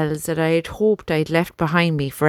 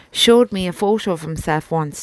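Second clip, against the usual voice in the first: ordinary delivery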